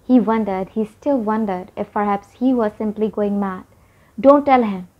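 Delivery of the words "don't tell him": ordinary delivery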